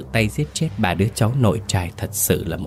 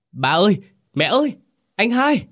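high, frantic cries for family; panicked calling out; 13 semitones higher, 4 dB louder than usual. A narrator's reading. Trung calls his family in the burning house, panicked calling. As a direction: call out in panic, pitch leaping way up. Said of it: "hốt hoảng lao vào trong gọi lớn".

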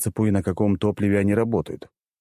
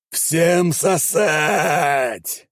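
high, long drawn-out yell; battle cry; 9 semitones higher, much slower than usual. A narrator's reading. Chief inquisitor launches the charge, high drawn-out battle cry. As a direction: bellow it as a war cry, stretching every word. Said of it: "заорал главинквизитор".

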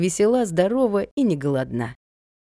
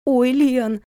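high, louder grateful exclamation; overjoyed; 7 semitones higher, 5 dB louder than usual. A narrator's reading. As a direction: burst of delighted thanks. Sister gratefully hugs her.

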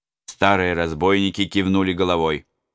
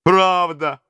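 put-on old robber; 12 semitones higher, 5 dB louder than usual. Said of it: "отозвался один старый разбойник".

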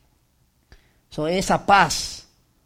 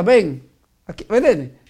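louder mock call, come here; calling someone over; 4 dB louder, slower than usual. Preacher acts out a man being called to face death, mock call. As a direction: beckon someone like a challenger.

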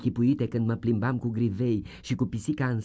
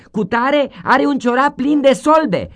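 put-on tattling child; 10 dB louder than usual.

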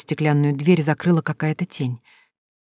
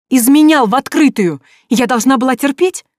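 high, loud indignant cry; outraged cry; 8 semitones higher, 6 dB louder than usual. A narrator's reading. Sonya protesting his open cheating, outraged shout. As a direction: keep shouting at him.

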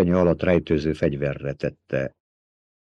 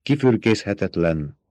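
usual speaking voice

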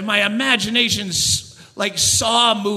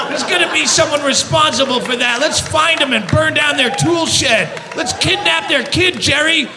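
raging rant; 4 semitones higher than usual. Acted. Ranting about airline blame.

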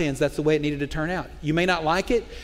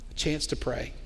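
hushed; 4 semitones lower, 9 dB quieter than usual. Real, not acted. Repeated soft close.

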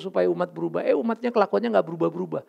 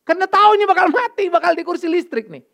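anguished; 11 semitones higher, 8 dB louder than usual. Real, not acted. Preacher's anguish over condemned.

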